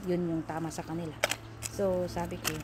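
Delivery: ordinary talk, nothing marked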